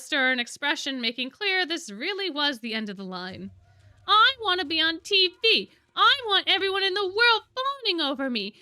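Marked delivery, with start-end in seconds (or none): 4.1-8.5 high, loud bratty demand; tantrum. A narrator's reading. Grim begs to be on TV, tantrum. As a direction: stamp out each wish like a spoiled child. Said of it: "in full temper tantrum mode".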